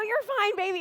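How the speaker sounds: high, sweet reassurance; mother comforting daughter; soothing mom. Retold or imitated. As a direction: soothe her like a little one. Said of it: "I go".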